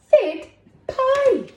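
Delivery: very high and loud squeal; squealed alien greeting. Acted. Alien greeting cherry class, loud excited squeal. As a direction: squeal "Fid pie" with delight. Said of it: "because that's what he's saying".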